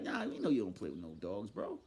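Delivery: low, soft imitation of loyal friends; mock defending friends. Imitating friends backing him up, low and soft. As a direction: lightly voice the friends brushing it off.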